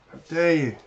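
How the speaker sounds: put-on huffy British accent indignation. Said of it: "I am not losing my British accent".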